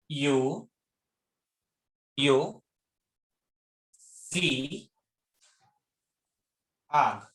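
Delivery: each word drawn out with pauses; slow spelled-out demo. Shown as a demonstration. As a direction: say it word by word for the class. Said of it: "normal वे से बोला उसने". (धीरे-धीरे एक-एक शब्द)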